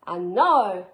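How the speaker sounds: loud, ringing call; cried out. Acted. Max calls out to the wild things, ringing cry. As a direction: call the rumpus to begin. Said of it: "cried Max".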